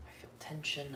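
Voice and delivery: breathy whisper, letting it out. Real, not acted. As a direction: whisper it to yourself. Quiet emotional aside.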